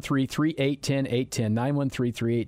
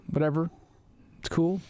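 ordinary talk, nothing marked

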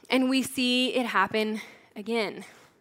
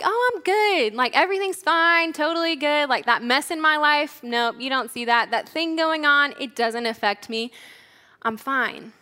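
breezy denial; 3 semitones higher than usual. Acted. Someone asked if they're okay, breezily covering up. Imitates people hiding their troubles.

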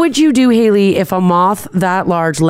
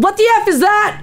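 high startled yell; retold scream. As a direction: shriek it in a panic. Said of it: "I screamed so loud".

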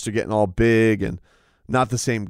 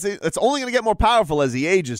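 usual speaking voice

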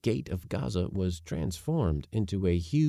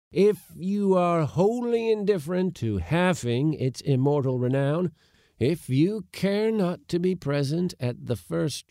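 higher, emphatic, grandly offended speech; indignant oration; 7 semitones higher, 4 dB louder than usual. A narrator's reading. Uncle offering to put his nephew ashore, indignant. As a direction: build a pompous ultimatum, phrase by phrase.

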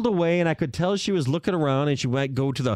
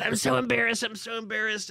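higher, fading mock embarrassment; mock embarrassed woman; 5 semitones higher, 3 dB quieter than usual. Imitating her hiding her face, lightly higher and fading. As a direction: hide behind your arms and whimper the line. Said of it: "like she's so embarrassed".

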